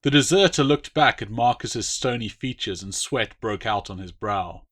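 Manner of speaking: usual speaking voice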